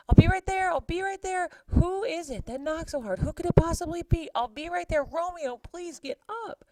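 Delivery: high, loud frantic calling to the door; yelling friar. Acted. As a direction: shout toward the knocking, flustered. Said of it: "and he's yelling".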